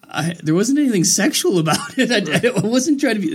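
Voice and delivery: a laughing protest, voice well up; nervous laugh. Retold or imitated. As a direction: laugh it off nervously while you explain. Defending himself, laughing nervously.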